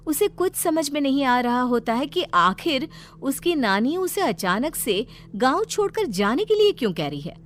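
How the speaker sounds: ordinary delivery, nothing marked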